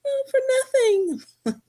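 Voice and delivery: put-on high, whiny sobbing child voice; mimicking crying child. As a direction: play the weepy little son, wailing. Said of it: "He's like".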